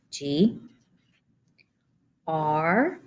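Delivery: normal talking voice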